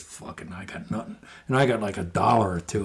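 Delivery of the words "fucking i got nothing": very soft, breathy mumble; mumbled. Retold or imitated. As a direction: mutter it under your breath. Doug mutters, broke.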